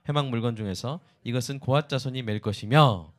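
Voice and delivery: scripture reading. Acted. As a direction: read the verse aloud to the congregation. Reads scripture aloud. (성경 봉독하듯 또박또박)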